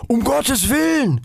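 high, stretched goose cry; alarmed shriek. A narrator's reading. Goose startled by falling stick, high alarmed cry. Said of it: "schrie die Gans auf".

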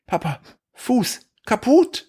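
put-on high toddler voice, slow single words; small high voice, word by word; imitating toddler son. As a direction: mimic a little child reporting damage. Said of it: "saß er da im Stuhl und meinte so".

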